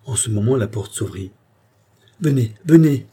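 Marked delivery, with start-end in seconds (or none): 2.2-3.1 loud, raised-pitch urgent call; crying out. A narrator's reading. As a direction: call out to summon someone at once. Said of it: "s'écria la reine". (appel pressant, voix forte et haute)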